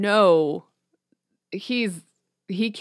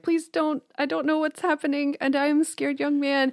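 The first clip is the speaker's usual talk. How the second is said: higher, pleading mock fear; lightly acted scared novice, higher voice; scared young man; 8 semitones higher than usual. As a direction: play the frightened young monk, begging. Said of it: "his hands are kind of out in this, you know, way".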